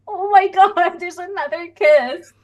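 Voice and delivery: high-pitched, excited mock scream; retold excited scream. Fan retelling her shock at another kiss, excited mock scream. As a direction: squeal it, imitating your own thrilled reaction. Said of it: "like, screamed".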